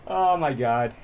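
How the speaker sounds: ordinary talk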